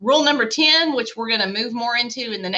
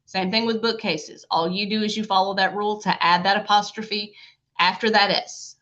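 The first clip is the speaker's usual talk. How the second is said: ordinary talk, nothing marked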